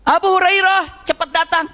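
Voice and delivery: high, slow summoning yell; acted mother calling loudly. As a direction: summon your son from far off. Mother calls son from afar.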